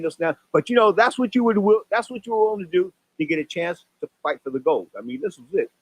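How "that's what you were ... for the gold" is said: ordinary delivery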